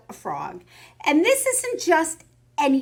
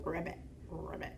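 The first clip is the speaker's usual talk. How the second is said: quiet ribbit croaks; frog croak; 7 semitones lower, 18 dB quieter than usual. Acted. Frog puppet sounds.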